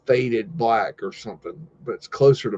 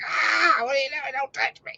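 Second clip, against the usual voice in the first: much higher, yelping animal voice; panicked zebra voice; 4 dB quieter than usual. Retold or imitated. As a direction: squeal it like a spooked zebra.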